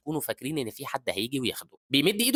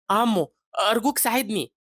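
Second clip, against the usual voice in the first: put-on high, slow, loud plea of a child; tearful plea; 10 semitones higher, 6 dB louder than usual. Boy calls out to a man in the street, tearfully pleading. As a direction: act a crying child begging for help. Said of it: "وبيقول له وهو بيعيط".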